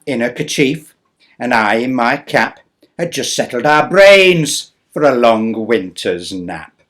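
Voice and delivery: measured bedtime-story reading; storybook narration. Acted. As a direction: read aloud to children. Poem narration.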